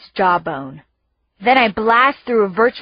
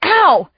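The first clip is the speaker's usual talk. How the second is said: high, loud yelp of pain; 9 semitones higher, 6 dB louder than usual. A narrator's reading. Brother yelping when burned, pained yelp. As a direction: yelp as you get burned. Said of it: "he yells".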